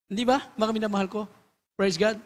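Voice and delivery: usual speaking voice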